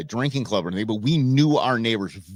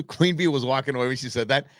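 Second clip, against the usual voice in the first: usual speaking voice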